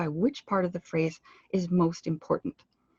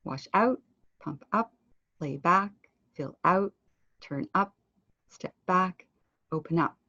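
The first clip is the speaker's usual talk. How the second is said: OUT, UP and BACK stronger than the verbs; stress on second part. Shown as a demonstration. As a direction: lift the second word: "out", "up", "back". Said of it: "You're saying the second part louder".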